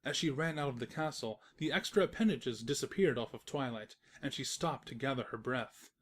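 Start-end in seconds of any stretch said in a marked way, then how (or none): none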